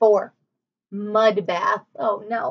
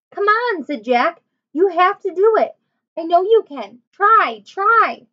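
high, louder pleading encouragement; urgent urging; 7 semitones higher, 5 dB louder, slower than usual. Acted. Jack urging Annie out of the mud, high and louder. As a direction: push her on, higher and louder each time.

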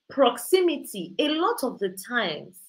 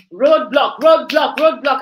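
loud, punched, repeated alarm; imitated blaring warning, each word hit hard; insistent mock warning; 9 dB louder than usual. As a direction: bark it out like an urgent warning.

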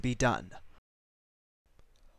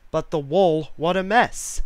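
exclaimed dismay; 3 semitones higher, 8 dB louder than usual. A narrator's reading. Narrator sees the cat has dirtied the wall, dismayed. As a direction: punch it up in dismay.

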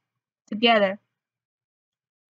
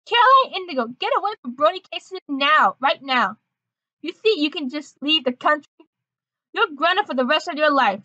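raised, higher-pitched parental scolding; angry scolding mom; 4 semitones higher than usual. Acted. Mom orders Caroline away from Brody, angry. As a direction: lay down the law as a cross mom.

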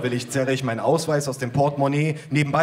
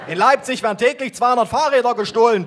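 much higher, louder female cop voice; yelling policewoman; 10 semitones higher, 5 dB louder than usual. Acted. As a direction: bellow the statistic as the policewoman. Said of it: "brüllt die Polizistin".

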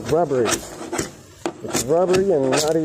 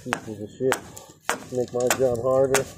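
ordinary talk

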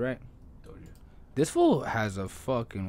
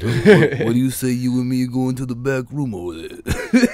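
Stallone voice; 3 semitones higher, 9 dB louder than usual. Retold or imitated. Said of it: "Sylvester Stallone comes on there and be like".

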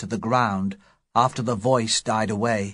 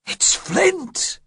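much higher frightened cry; panicked high cry of recognition; terrified cry. Acted. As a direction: blurt it out in terror. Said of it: "cried George Merry".

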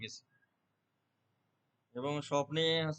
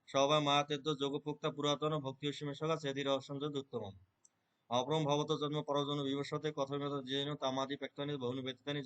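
Gita recitation; 3 semitones lower, 4 dB quieter than usual. Shown as a demonstration. Reciting Gita chapter four from memory for the interviewer, lightly intoned. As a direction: recite the fourth chapter in an even devotional flow. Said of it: "যেমন হচ্ছে আমি উচ্চারণ করলাম না ওনার সামনে".

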